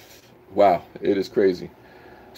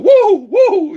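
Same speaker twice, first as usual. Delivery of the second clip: very high, loud whooping cheer; a big woo for a fan; 10 dB louder than usual. Real, not acted. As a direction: whoop it out at the top of your voice. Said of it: "I'll give you a woo for Lynn Denton".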